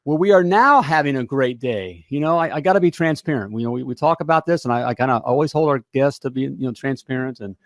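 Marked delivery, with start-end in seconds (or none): 0.0-2.0 big welcoming show opener, stretching the words; radio host intro. Acted. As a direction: pump up the crowd like a live radio host. Show host's opening announcement over music.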